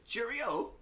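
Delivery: very high mock-British "cheerio"; bad English accent. Echoing the co-host's joke, squeaky mock-English accent. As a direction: chirp it like a cartoon Brit. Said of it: "We're terrible at your accent".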